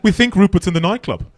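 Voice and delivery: high, prim mock English mother; posh English mother. English mother wakes Hugo over Rupert, prim and high. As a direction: play the proper mother waking the father. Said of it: "The English mother would go in".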